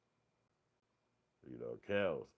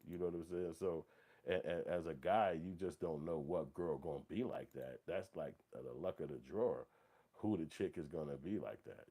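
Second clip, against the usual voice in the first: ordinary talk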